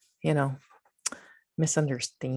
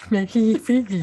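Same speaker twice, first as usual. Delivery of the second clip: laughing; 6 semitones higher, 8 dB louder than usual. Real, not acted. Laughs while repeating phrase.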